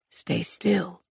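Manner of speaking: soft but gruff male voice; gruff order. A narrator's reading. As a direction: order it gruffly, without raising the voice. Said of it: "he ordered gruffly".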